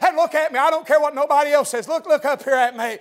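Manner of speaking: normal talking voice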